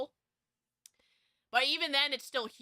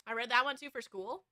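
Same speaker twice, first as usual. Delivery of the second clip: ordinary talk, nothing marked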